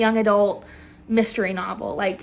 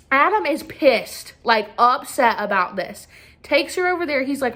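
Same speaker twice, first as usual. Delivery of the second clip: ordinary talk, nothing marked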